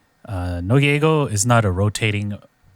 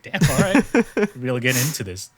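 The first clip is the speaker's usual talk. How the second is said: grudging concierge; 4 semitones higher than usual. Retold or imitated. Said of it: "It's like".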